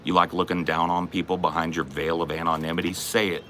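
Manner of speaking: ordinary talk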